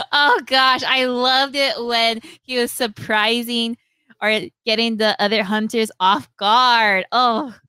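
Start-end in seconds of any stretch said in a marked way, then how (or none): none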